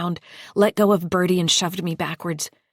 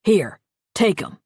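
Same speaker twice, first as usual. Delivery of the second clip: louder forceful order; harsh command; 5 dB louder than usual. Acted. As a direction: bark it at her. Lester forces pills.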